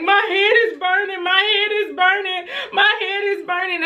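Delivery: put-on high whiny child repeating the complaint; high, whimpering kid's voice; crying child. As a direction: imitate a little girl wailing in pain. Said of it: "she's crying. It's like".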